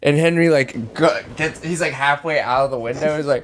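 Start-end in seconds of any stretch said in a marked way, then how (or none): none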